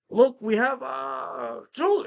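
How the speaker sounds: high imitated Spanish accent on broken English; a little accent. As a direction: mimic the hustler's accented sales pitch. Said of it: "you know, a little accent".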